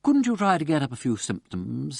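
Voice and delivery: a mild touch of sarcasm; lightly mocking, needling question; sarcastic. A narrator's reading. Wimsey needling the poisoner, mild sarcasm. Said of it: "said Wimsey sarcastically".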